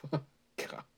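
ordinary delivery

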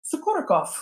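surname in a put-on Russian accent. Shown as a demonstration. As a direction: say Sokurokov like a Russian. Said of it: "in your best Russian accent, just say it".

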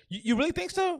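high, doubtful little question; imitating mild disbelief. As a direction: pitch it way up as a sceptical query, without aggression. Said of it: "He didn't say it like that. He was just kinda like".

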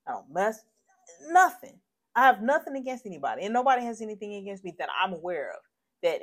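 worked-up, aggrieved venting; indignant protest. Real, not acted. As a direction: protest your innocence with real outrage. Venting at unknown shooter.

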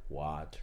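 low, soft "Water" with a deliberate T; weird-sounding water. Shown as a demonstration. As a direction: try out "Water" slowly with the t sounded. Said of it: "Just saying that sounds weird".

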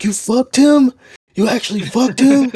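high, loud mock disbelief; outraged boyfriend. Boyfriend confronting his cheating girlfriend, mock outrage. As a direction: imitate a cheated boyfriend's shrill-pitched outburst. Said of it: "'cause he's like".

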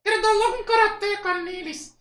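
very high, loud puppet voice; Kleber. Acted. Puppet threatening staff with karate, high puppet voice. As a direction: threaten as a squeaky dummy. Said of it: "acha uma voz que pareça com o boneco".

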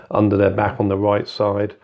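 ordinary delivery

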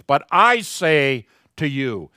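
I stretched out loud and slow; loud, weighty stress on I; emphatic I. Retold or imitated. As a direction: punch the word I, slowly and forcefully. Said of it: "it's very emphatic in each one".

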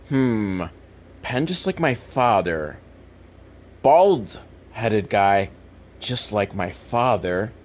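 low, slow, puzzling it out; slow pondering. Retold or imitated. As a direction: piece it together slowly, under the breath. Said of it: "he's like".

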